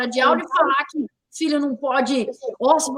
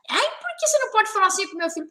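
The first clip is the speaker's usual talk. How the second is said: mocking whiny mother; 7 semitones higher, faster than usual.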